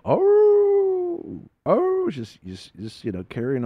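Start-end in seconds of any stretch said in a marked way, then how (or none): 0.1-1.4 long, high, loud imitated howl; dog howl. Storyteller imitating the dog on the dirt road, howling. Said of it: "hearing this dog howl". 1.7-2.1 high imitated howl; dog howl. Storyteller imitating the dog carrying on, howling. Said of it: "hearing this dog howl".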